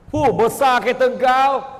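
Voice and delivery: loud, high sneering jab; envious jeer. Acted. Gossiping woman jeers loudly at a new car. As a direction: sneer at the neighbour's big car.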